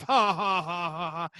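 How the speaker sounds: mocking ha ha; fake laugh. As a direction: laugh it off scornfully.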